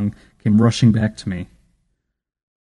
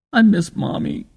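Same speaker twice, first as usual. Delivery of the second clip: put-on much higher, small boy's sad voice; small child's lament; 11 semitones higher than usual. A narrator's reading. Grieving boy tells grandmother, high small child's voice. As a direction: say it like a little boy missing his mother.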